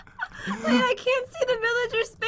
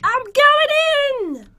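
higher, loud, stretched-out call; excited announcement; 5 semitones higher, 5 dB louder, much slower than usual. Real, not acted. Player jumping into the water hole, excited call. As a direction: call it out as you dive in, slowly.

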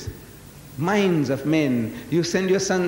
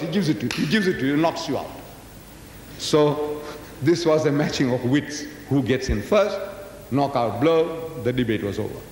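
ordinary talk

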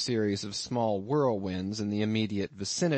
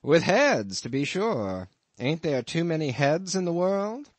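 a lightly voiced innkeeper, higher and a bit louder; amused landlord; 6 semitones higher, 4 dB louder than usual. Innkeeper teasing his lodger about the harpooneer, lightly voiced. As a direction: spin the tale with a sly chuckle underneath.

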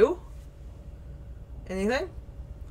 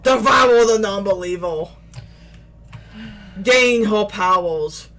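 booming demon voice; 5 dB louder than usual. Acted. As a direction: proclaim it like a monster. Game narrator-like villain lines.

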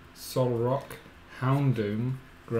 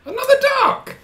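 excited shout; 9 dB louder than usual. Real, not acted. Excited card reveal call.

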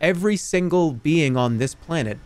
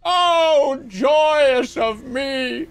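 high, slow, drawn-out rapture; hammed-up bliss, very slow; rapturous; 12 semitones higher, 5 dB louder, much slower than usual. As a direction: melt in ecstasy. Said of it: "and I'm looking up".

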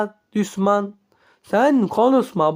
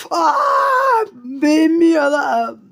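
high, slow, sobbing shout; slow tearful high yell; crying shout; 8 semitones higher, 3 dB louder, slower than usual. Acted. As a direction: wail it out in tears. Scared character cries out.

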